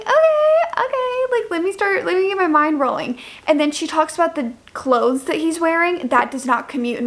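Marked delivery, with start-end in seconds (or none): 0.0-3.2 mock excited reaction. Her own reaction voiced.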